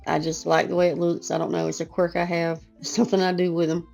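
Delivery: ordinary delivery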